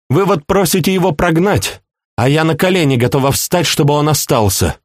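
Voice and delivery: high, urgent princess; impassioned plea. A narrator's reading. Princess begging that her beloved may stay, impassioned. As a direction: plead in a high, pressing voice.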